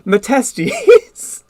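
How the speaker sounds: giggling through the name; laughing. Real, not acted. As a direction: laugh it out as you say it. Laughing at own joke.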